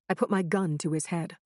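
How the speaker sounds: ordinary delivery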